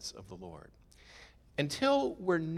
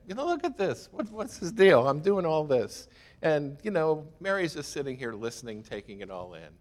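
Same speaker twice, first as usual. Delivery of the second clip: much higher, indignant mock complaint to Jesus; spitfire Martha; 4 semitones higher, 4 dB louder than usual. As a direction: tattle on your sister like a fiery hostess. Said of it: "being the spitfire that she is".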